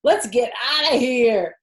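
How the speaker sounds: high, loud yells, slow on what a stink; boy yelling in disgust; screaming. Acted. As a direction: shout in disgust and flee. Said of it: "screamed Avery".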